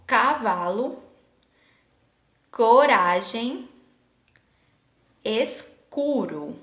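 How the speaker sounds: drawn-out "Cavalo, coragem, escuro" with C as K; teacher stretching each syllable of "Cavalo, coragem, escuro"; hard C demo. Shown as a demonstration. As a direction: sound out "Cavalo, coragem, escuro" slowly, pausing between words. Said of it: "perceba aqui o som".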